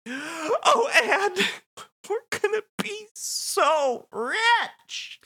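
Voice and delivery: high, tearful, very slow; Nadine voice. Acted. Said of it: "And she says".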